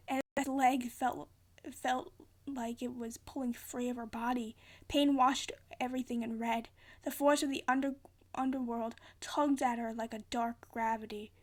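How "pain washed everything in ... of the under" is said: ordinary delivery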